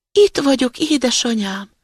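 put-on high, tiny kid-goat voice; thin little voice. A narrator's reading. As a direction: pipe up high to mother. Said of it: "megszólalt egy cingár hangocska". (cingár, magas kis hangocska)

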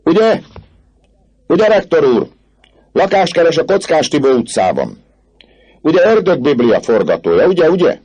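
very high, loud taunting; frenzied, insistent jeering; thunderous mocking chorus. A narrator's reading. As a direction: hurl the pun back as a whole gang. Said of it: "Az egész banda mennydörgésszerű hangon".

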